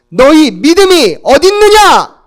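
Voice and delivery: loud, high scolding thunder; Jesus scolding the disciples, loud; stern rebuke. Acted. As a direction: rebuke them for their little faith. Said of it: "주님이 호통치세요".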